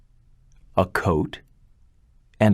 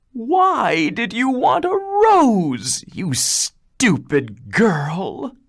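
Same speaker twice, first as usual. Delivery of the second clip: high, slow spiteful scolding; scornful sisters; 14 semitones higher, slower than usual. Acted. Older sisters scolding Beauty for the rose, high slow scorn. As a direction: blame her with contempt.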